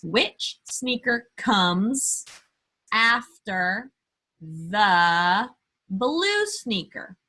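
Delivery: slow teacher question. Acted. Slow drawn-out question to kids.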